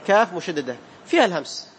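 ordinary talk

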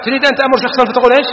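ordinary talk, nothing marked

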